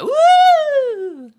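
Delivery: very high loud whoop; party woo. Pretend. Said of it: "We're like".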